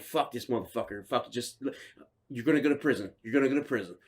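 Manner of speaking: low, soft grumbling; muted self-talk. Retold or imitated. Man at gas station warning himself of prison, low self-talk.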